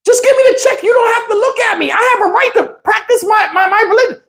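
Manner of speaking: very high imitated woman's voice; high-pitched comic pleading; mock protest. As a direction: protest in a hammed-up falsetto. Imitating woman protesting as visitor leaves.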